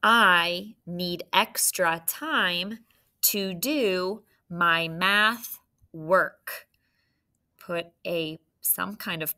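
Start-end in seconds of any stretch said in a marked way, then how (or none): 0.0-6.7 slow, louder word-by-word read; slow dictation. Shown as a demonstration. Teacher rereading the dictation for spelling. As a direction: dictate it once more, carefully. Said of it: "I'll read it one more time".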